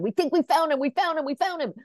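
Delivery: high and eager, tumbling out; excited news. Retold or imitated. Casting director phoning producer Rawson about the find, excited. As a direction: blurt it like you struck gold.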